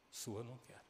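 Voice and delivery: soft, restrained refusal; quiet rejection. Retold or imitated. Mother refusing this son's share of the gift, quiet rejection. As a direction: say the refusal under your voice.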